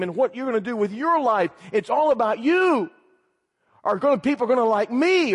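high, hammering run of challenges; impassioned preaching. Real, not acted. Preacher confronting self-absorbed young people, impassioned preaching.

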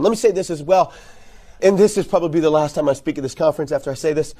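ordinary talk, nothing marked

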